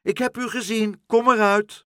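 high call building to a loud shout; high, insistent summons. Acted. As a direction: shout for someone hiding to show themselves. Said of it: "riep Pluk".

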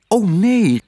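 high cry of refusal; emphatic, high protest. A narrator's reading. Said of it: "riep Otje".

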